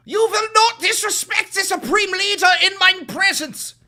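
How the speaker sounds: screaming zealot, high and loud; hammed-up outraged loyalist yelling; shrieking mock fascist; mock fanatic shout. Mocking a MAGA fanatic in chat.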